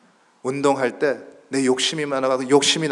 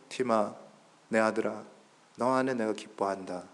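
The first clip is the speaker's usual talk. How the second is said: very soft, low voice; tender fatherly affirmation; 4 semitones lower, 9 dB quieter than usual. Acted. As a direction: address your son quietly and lovingly. God speaks lovingly to his son. (낮고 부드럽게 아들을 부르는 아버지)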